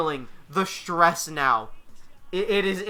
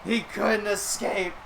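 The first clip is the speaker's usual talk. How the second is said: slow, weighty announcement of the winner; slow dramatic call; 2 semitones higher, slower than usual. Acted. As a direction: let each word land. Match ends, dramatic verdict.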